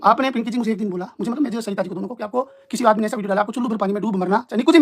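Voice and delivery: ordinary delivery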